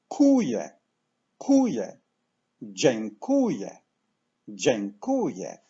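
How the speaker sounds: dziękuję with KU stressed, falling away on je. Shown as a demonstration. Teacher building the word from the end, stress on KU. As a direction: lean on the middle syllable KU in kuje and dziękuję. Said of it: "word stress falls on the syllable ku".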